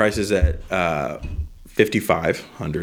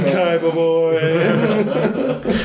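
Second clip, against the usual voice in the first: drawn-out, laughing villain purr, much higher; Maximilian Pegasus; 8 semitones higher, much slower than usual. Acted. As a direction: stretch it slowly with a sly laugh. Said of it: "dipping into be like Maximilian Pegasus".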